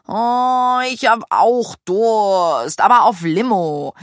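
quengelig, stretching out the words; whiny. A narrator's reading. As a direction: whine like an impatient child. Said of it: "quengelte Maya".

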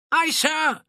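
very high, crisp sailor's shout; shouted acknowledgement. A narrator's reading. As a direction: shout back to the captain. Crew shouts back acknowledgement.

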